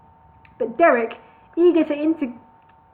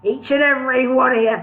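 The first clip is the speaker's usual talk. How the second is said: bellowed; 3 dB louder than usual. Acted. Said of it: "he bellowed".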